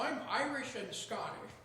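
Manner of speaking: ordinary delivery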